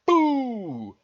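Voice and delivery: loud, high, drawn-out boo; big storybook scare; playful ghost shout. A narrator's reading. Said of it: "try it on and shout".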